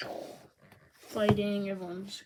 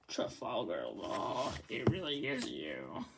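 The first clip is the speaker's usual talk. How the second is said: much lower, softer and slow villain voice; low stunned villain; 11 semitones lower, 4 dB quieter, slower than usual. Acted. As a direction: growl it out low like a shaken rival. Voicing stunned enemy.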